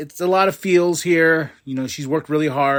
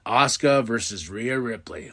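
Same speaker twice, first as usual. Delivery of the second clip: ordinary delivery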